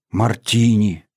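lightly aspirated, reverent wonder; understated breathy reverence; breathy awe. A narrator's reading. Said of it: "с придыханием сказала Рита".

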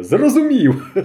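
high teasing question through a laugh. A narrator's reading. Polivoi teases Mishko about the train, laughing. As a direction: tease him with a chuckle. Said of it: "Він розсміявся".

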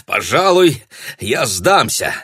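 чуть выше, громко и открыто; объявляет залу о сдаче; Шенди к залу. A narrator's reading. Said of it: "сказал Шенди, обращаясь к залу". (громкое обращение к залу)

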